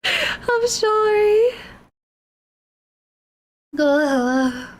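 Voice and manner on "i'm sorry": teary apology. Real, not acted. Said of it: "a little cathartic".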